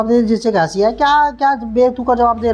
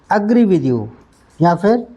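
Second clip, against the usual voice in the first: ordinary talk, nothing marked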